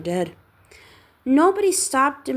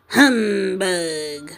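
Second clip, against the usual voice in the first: scornful humbug; 2 semitones lower, 3 dB louder than usual. Acted. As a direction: sneer the word out. Scrooge scoffs at Christmas.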